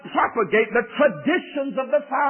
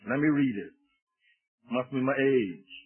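quiet aside; 9 semitones lower, 6 dB quieter than usual. Real, not acted. Aside while finding the verse.

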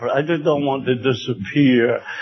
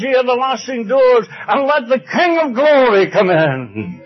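raised, ringing pulpit voice building to a climax; rousing preaching; 8 semitones higher, 4 dB louder than usual. Real, not acted. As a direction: preach it triumphantly, pitched high. Sermon climax, quoting psalm.

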